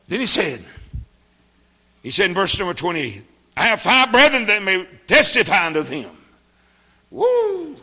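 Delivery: high, loud ringing sermon cry; preacher shouting the verse and a joyful woo; preaching at full force. Acted. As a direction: whoop and lift the verse to the rafters. Preaching climax.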